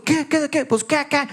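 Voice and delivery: high, fast challenge thrown back; mock defiant retort. The other driver answers the father's challenge, defiant. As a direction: bark it back like a street fight.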